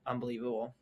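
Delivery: soft, understated disbelief; disbelieving mom. Retold or imitated. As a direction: imitate a mother's quiet disapproval. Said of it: "And then my mom's like".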